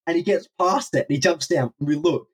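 usual speaking voice

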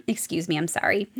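ordinary delivery

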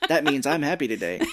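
mock German. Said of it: "lady I used to work with that was German".

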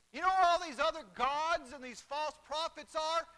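loud, hammering pulpit delivery; full-force preaching. Real, not acted. Preacher tells congregation false prophets are buried, forceful. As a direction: drive each point home loudly.

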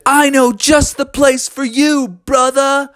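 high, hearty cartoon voice; keen eagle. Eddie offering Froggy an adventure, high keen reply.

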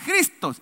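"Cristos" said like the Greek original; Greek pronunciation. Shown as a demonstration. Pastor has the church repeat "Cristos", the Greek way. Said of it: "cómo decía el original".

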